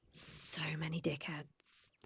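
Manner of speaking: hushed confession; whispered. Real, not acted. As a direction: drop to a whisper. Confessing past partners, whispered.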